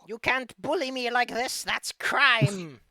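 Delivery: high, loud whining witch; whiny old hag. Acted. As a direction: protest like a wounded old hag. GM as hag protests.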